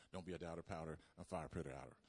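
quick tongue-twister run; said real fast. Shown as a demonstration. Preacher tells the congregation to say it fast, rapid. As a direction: rattle it off fast and low. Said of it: "Now say it all real fast".

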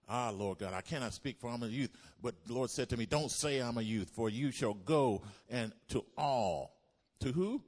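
loud, driving scripture reading; preacher reading scripture to the congregation, loud and forceful; full-force preaching. Real, not acted. Preacher calls scripture out to the congregation, preaching at full force.

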